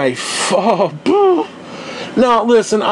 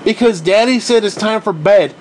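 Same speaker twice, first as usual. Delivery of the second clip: ordinary talk, nothing marked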